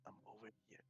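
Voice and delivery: very soft hushed reply; barely audible, secretive answer; whisper. Acted. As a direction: whisper back from hiding. Whispered reply while sneaking.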